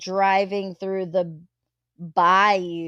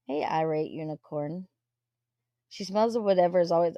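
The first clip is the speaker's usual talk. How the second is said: ordinary delivery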